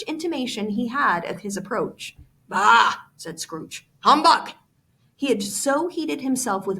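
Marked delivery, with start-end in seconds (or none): 2.5-2.9 loud scornful "Bah!"; grumpy bah. Acted. Scrooge dismissing his nephew, loud scornful bah. 4.0-4.5 loud, higher scornful "Humbug!". Acted. Scrooge scoffing at Christmas, loud scornful humbug.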